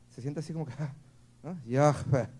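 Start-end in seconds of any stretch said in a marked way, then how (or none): 1.6-2.3 Yahvé pushed out on a loud breath. Shown as a demonstration. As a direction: say Yahvé as a strong exhale. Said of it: "requiere un esfuerzo de respirar". (Yahvé dicho con un fuerte soplo de aire)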